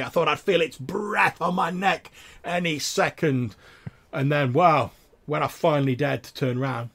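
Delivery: country yokel building up his monster story; eager tavern tale-teller. Acted. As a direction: play the trapper relishing a new audience.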